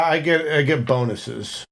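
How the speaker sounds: usual speaking voice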